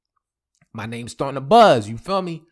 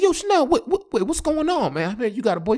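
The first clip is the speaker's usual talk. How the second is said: panicked disbelief; 11 semitones higher, faster than usual. Retold or imitated. Panicked call to ex.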